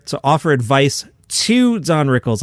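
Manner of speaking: pause, then slow, drawn-out "to"; stress on TO. Real, not acted. Host telling of comics advising Don Rickles, stress on "to". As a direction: lean hard on "to" before the name. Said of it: "I did not misspeak".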